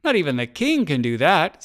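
excited admiration from a spectator; joyful onlooker. A narrator's reading. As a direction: marvel aloud with delight. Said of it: "The crowd was filled with joy".

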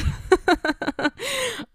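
sudden high giggle; high burst of laughter. Real, not acted. Host laughs at herself for ranting at listeners, high giggle. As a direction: laugh at yourself. Laughing at her own rant.

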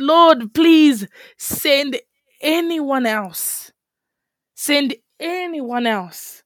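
slow, drawn-out mock plea; beg, stretching out each phrase; acted pleading. Acts out Moses begging God.

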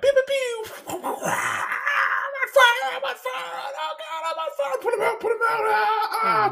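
squeaky little guy screaming on fire; wounded soldier gasping. Acted. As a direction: shriek it out like a tiny cartoon victim. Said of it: "A little guy that sounds kinda like Mickey Mouse".

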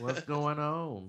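slow, hollow masked voice. Pretend. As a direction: speak like a talking mask, slowly. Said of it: "[name] has his Mask for Mask voice on tonight".

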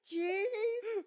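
very high, loud mock scream; piercingly high, drawn-out yell; imitated scream. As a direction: scream out the name like a horror-film heroine. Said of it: "just scream it".

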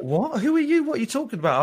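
imitating the senior director's shock at a stranger; startled what. Senior director asked about ASMR in a lift, startled. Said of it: "And he's like".

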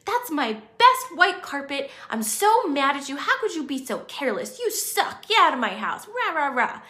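loud, high furious outburst over the carpet; angry friend. Acted. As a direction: yell at them like they ruined everything. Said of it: "and your friend's like".

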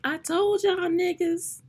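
high, drawn-out "told you so"; imagined gloating, high and stretched out; mock vindication. Imagined future vindication, voiced as a quote.

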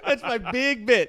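slow, high, laughing punchline; laughing slowly. Real, not acted. Friends laughing at the comedy show joke, slowly. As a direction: stretch it out while cracking up.

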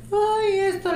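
very high-pitched mock-romantic put-on voice; feigned romantic voice. Woman mocking the romantic voice she refuses to fake, mock-romantic. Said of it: "Yo no voy a andar fingiendo".

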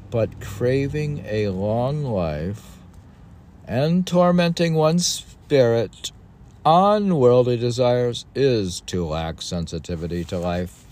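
put-on high, loud, solemn recitation of old text; declaiming recital. Reading an ancient text aloud to a co-host, solemnly declaimed. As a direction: read it like scripture from a lectern.

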